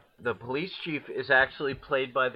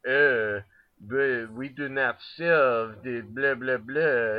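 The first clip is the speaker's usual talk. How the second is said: French waiter; 4 dB louder, slower than usual. Retold or imitated. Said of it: "the waiter being like".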